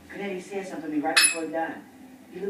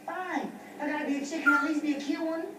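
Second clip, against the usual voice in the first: put-on girly voice; 10 semitones higher, 4 dB louder than usual. Playing a girl in disguise.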